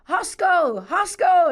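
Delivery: high, loud Irish call; attempted Irish accent. Friend's father hails her at the boundary, attempted Irish accent. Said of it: "that's my Irish accent attempt".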